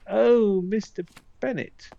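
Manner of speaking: high, breathless mock swoon; hammed-up lovestruck maid; swooning servant girl. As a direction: play the flustered servant girl gushing over a letter. Swoons over a love letter.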